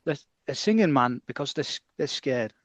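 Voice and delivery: lightly attempted football manager's accent; halting, half-hearted manager impression; Bobby Robson. Co-host has a go at the England manager on the singing players, tentative impression. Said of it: "trying to do a Bobby Robson impression".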